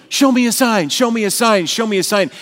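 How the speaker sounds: hammed-up crowd insisting on proof; demanding shout. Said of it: "because of our disbelief of".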